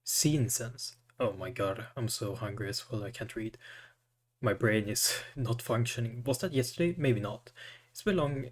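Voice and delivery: ordinary talk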